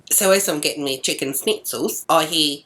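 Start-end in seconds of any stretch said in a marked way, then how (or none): none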